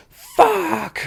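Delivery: very high mock shriek of a curse; imitated outburst. Imitating an actor's girlfriend on the phone about shaved eyebrows, mock shriek. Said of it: "then she was just like".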